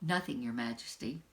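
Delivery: low, soft, ashamed reply. Acted. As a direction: confess it quietly with tears near. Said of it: "said softly".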